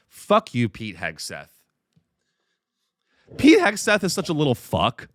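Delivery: ordinary talk, nothing marked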